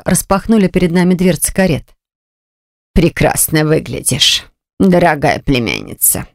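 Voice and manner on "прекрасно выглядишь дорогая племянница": low, slow, cold aunt; icy voice. Acted. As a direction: chill each word, slow and low. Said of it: "Ледяной голос". (ледяной голос тётушки)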